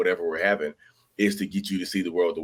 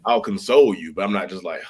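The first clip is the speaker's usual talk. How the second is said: ordinary talk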